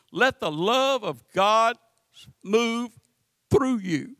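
normal talking voice

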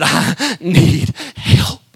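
slow, broken plea for help; weeping plea. Retold or imitated. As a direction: drag out each word as if choking back tears. Tearful plea at pastor's door.

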